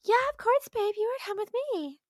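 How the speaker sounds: very high mock girlfriend voice; girlfriend impression. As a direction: chirp back agreeing as the girlfriend. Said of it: "Do you like my impressions?".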